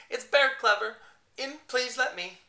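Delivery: high, loud, jumbled plea; nonsense voice. Acted. Brainless bear at door, loud jumbled nonsense. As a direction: blurt the words in scrambled order. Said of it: "A voice didn't make any sense at all".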